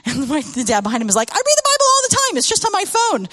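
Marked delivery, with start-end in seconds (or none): 1.3-3.3 high, fast, loud mock-defensive dad; imitating a dad's hurt indignation; indignant protest. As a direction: blurt it out like a caught father. Dad protests kid's claim.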